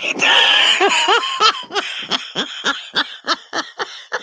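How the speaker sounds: long, high cackling laugh; uncontrolled laughter. Real, not acted. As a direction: burst into a real, helpless high cackle. Cracks up at a chat comment.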